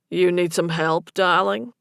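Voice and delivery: Boston barman; thick Boston accent. A narrator's reading. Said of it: "a man with a thick Boston accent asked".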